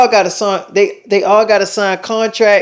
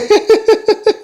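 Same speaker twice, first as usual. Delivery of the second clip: bursting laugh; 13 semitones higher than usual. Real, not acted. Laughs at the cartoon memory.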